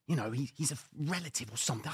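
ordinary delivery

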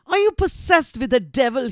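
loud, accusing story-reading voice; forceful, indignant question; savage. A narrator's reading. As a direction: demand it fiercely, punching each word. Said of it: "He pursued savagely".

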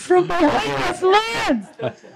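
high-pitched playful repeat; amused high echo. Retold or imitated. Echoing the joke about the neighbor behind plants, high-pitched.

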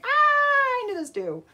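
high-pitched knowing exclamation. Acted. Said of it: "faces going".

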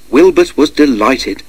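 ordinary delivery

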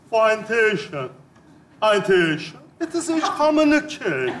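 slow, separated phrases with long pauses; word by word. Acted. Repeating it for Bahadır to translate, slowly and distinctly. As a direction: spell out the mock English piece by piece. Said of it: "Tane tane söyleyeceğim".

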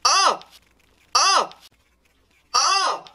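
loud, high cries of disgust; disgusted shout. Real, not acted. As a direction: recoil from a foul taste. Got the rotten egg bean.

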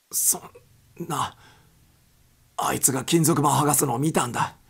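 agitated protest; desperate protest. Acted. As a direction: plead to be believed. Wilson protests disbelief.

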